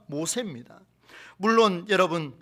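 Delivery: ordinary delivery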